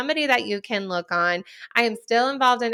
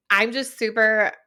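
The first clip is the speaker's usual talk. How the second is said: ordinary delivery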